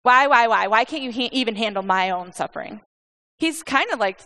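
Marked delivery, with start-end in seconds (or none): 0.0-2.8 loud, rushed complaint hurled at God; exasperated demanding protest, easing at the end; accusing outburst. Acted. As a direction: rail at God as a desperate Job, starting high and loud. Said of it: "basically saying, ... throwing these remarks at God".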